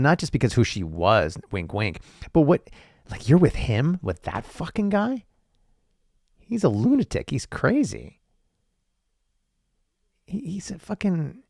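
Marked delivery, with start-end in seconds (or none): 2.3-11.4 incredulous scolding. Retold or imitated. Acted scolding of Mary.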